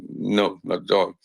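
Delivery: usual speaking voice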